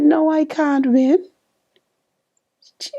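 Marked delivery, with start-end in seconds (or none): 0.0-1.2 high and pleading, 'No' lifted way up; stunned disbelief. Retold or imitated. To her daughter, hearing she won: high disbelieving protest. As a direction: protest the news you can't believe.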